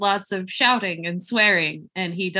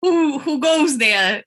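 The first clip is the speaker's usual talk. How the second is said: frightened; 4 semitones higher, slower than usual. Acted. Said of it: "so he reacts wildly".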